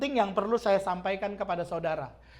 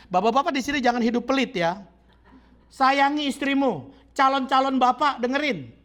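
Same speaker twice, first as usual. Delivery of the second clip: louder, urgent warning from the pulpit; fiery preaching; 5 semitones higher, 6 dB louder than usual. Acted. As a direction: drive the warning home to the men.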